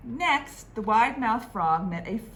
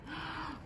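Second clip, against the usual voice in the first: excited gasp; 10 semitones higher, 11 dB quieter than usual. Acted. Reacting to fruit hat.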